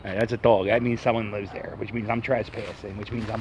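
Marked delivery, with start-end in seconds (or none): none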